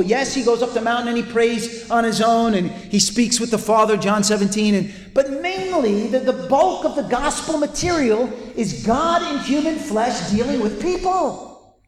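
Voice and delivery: ordinary talk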